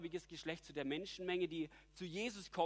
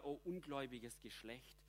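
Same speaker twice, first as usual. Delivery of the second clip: softer, anguished sigh of a cry; pained outcry; 3 semitones lower, 8 dB quieter than usual. Retold or imitated. Preacher voicing Jesus' anguished cry over unbelief, pained and soft. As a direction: let it out as a tormented lament, not a rebuke. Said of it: "Dieser gequälte Aufschrei".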